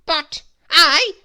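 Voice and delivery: loud, high protest cut short. Acted. Mister Mean protesting to the wizard, loud. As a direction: object loudly, then get cut off. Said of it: "protested Mister Mean".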